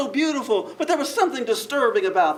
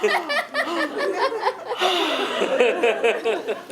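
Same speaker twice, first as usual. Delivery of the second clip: sigh; 8 semitones higher than usual. Shown as a demonstration. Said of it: "people just coming in".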